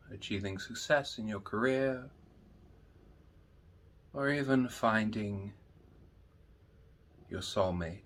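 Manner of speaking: soft, slow meditation voice with long pauses; guided meditation. Acted. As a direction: guide listeners gently inward, hushed and unhurried.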